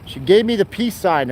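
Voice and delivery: normal talking voice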